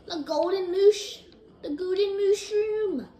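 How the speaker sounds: high, loud, drawn-out delight; excited exclamation. Real, not acted. Gamer spotting a golden mushroom, excited cry. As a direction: stretch it out in a rising cheer. Spots a rare item.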